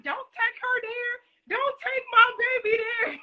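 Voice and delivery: high, slow, mock-tearful mom; high-pitched hammed-up weepy mother; imitating mom. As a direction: plead like a proud crying mama. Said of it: "I was like".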